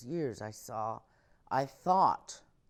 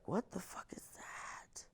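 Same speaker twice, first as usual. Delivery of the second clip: whispered parent; 8 semitones higher, 10 dB quieter than usual. Retold or imitated. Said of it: "my parents were like".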